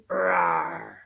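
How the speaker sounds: deep, low growly roar; lion roar. Shown as a demonstration. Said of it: "What does a lion say".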